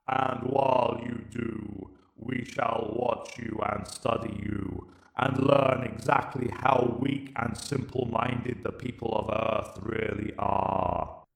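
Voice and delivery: slow, cold alien verdict; Dalek Emperor. Acted. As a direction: judge him like an unfeeling machine ruler. Emperor declares.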